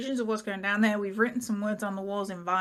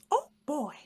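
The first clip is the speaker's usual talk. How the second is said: light Mickey Mouse voice; 6 semitones higher than usual. Acted. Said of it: "sounds a little bit like Mickey Mouse".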